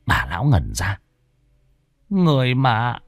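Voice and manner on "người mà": put-on higher, slow old lady voice telling a sad tale; old woman recounting. A narrator's reading. Old woman telling Trương about the ghost Chi, higher and slow. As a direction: recount the old grudge slowly and sorrowfully.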